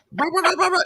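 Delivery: high imitated critter warble; funky warbling noise. Raccoon under the hammock answering each fart, warbling noise. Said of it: "do that funky little".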